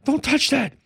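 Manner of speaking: scream. Retold or imitated. Grandmother yelling at grandson to not touch, imitated scream. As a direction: stop the kid from touching the tray. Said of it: "She screamed".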